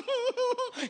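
high-pitched pretend sobs; mock child sobbing. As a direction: whimper like a crying little boy, hammed up. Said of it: "me encuentra llorando. Yo estaba así".

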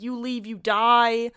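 slow, loud threatening read; a slow, menacing hint of a threat; menacing note. Reading the brick's threat slowly and loudly. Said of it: "and it's just like".

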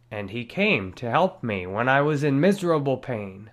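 ordinary talk

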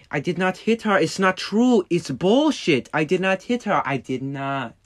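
deliberately bad Tommy Wiseau imitation; bad Tommy Wiseau impression. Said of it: "my horrible impression of Tommy Wiseau".

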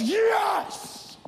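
hammed-up football guy celebrating; football man voice. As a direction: do a triumphant football-man cheer. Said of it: "her football man voice".

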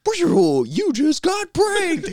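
loud, very high gleeful reveal; prank-call announcer. Retold or imitated. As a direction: blare it like a cartoonish gotcha recording. Voicing the prank app's reveal.

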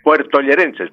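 usual speaking voice

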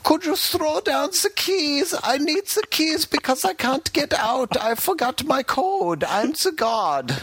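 very high, slow hammed-up German accent; mock German prisoner. Prisoner begging Watson to throw down the keys, in a high, slow, hammed-up German accent. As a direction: beg for the keys in a silly squeaky German voice. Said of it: "a German dude with the thick German accent".

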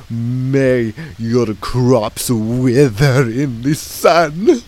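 loud, slow theatrical curse; laying a curse. Acted. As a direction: pronounce doom like a wizard. Clown curses the host.

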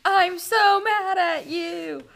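high, loud, slow hammed-up rage; playful overacted fury, words stretched; mock anger. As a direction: declaim it like a stage tantrum. Acts out angry door slammer.

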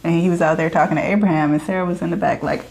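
ordinary talk, nothing marked

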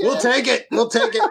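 high, laughing repeat. Real, not acted.

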